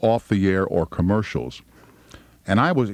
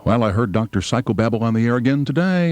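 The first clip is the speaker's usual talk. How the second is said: ordinary talk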